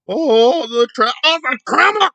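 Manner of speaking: mocking her wailing about trauma. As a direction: ham up a melodramatic victim moaning. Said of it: "'cause that's the trauma".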